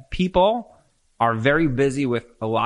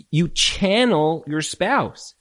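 ordinary talk

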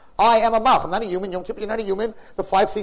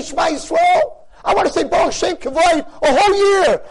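raised, higher, louder pleading prayer; impassioned preaching; 12 semitones higher, 7 dB louder than usual. Acted. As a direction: cry out your longing to God with full force.

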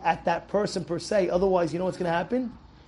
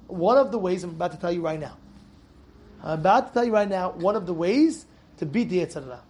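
usual speaking voice

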